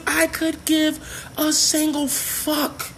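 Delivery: every word stretched out high; slow, high-pitched, spelled-out indifference; emphatic dismissal. Real, not acted. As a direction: draw out "single fuck" in a high pitch. Emphatic dismissal of complaints about rioters.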